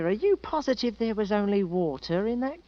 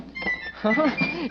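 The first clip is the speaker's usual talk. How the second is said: delighted laugh; 4 semitones higher than usual. Acted. Boys laugh at watchers.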